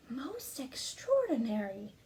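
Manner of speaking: slow, hushed awestruck doctor; amazed doctor. Acted. Doctor examining the striped girl, hushed amazement. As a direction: marvel under your breath. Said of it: "he exclaimed".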